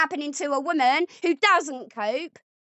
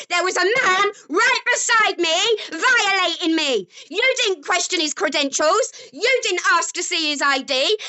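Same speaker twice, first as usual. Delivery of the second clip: angry; 5 semitones higher than usual. Real, not acted. To boyfriend, angry raised voice.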